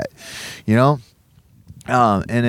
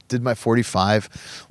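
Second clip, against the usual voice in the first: ordinary talk, nothing marked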